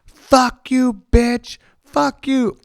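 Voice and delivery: high, loud imitation of a screaming girl; imitated scream. Angry girl screaming down the alley at the ladies, imitated scream. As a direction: shriek it like she's charging down the alley. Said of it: "just screaming".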